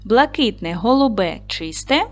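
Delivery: measured, expressive reading of each phrase, pausing after every word. Acted. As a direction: read each phrase aloud to the class, clearly and expressively. Reads word groups aloud. (виразне читання словосполучень з паузами)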